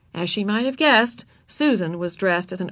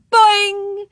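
high, loud springy shout; playful clown's yell; yelled boing; 12 semitones higher, 7 dB louder than usual. A narrator's reading. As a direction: join the teasing with a loud twang. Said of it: "He, too, tweaked a curl and yelled".

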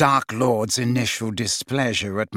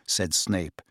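usual speaking voice

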